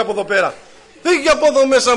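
loud, raging order to get out; angry shout. Retold or imitated. Old man chases the narrator out, loud angry yell. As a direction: throw him out of the shop. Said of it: "μου κάνει μια".

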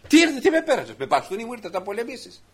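high, loud mocking outburst; scornful giant. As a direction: shout your contempt at the boy. Goliath scorns unarmed David.

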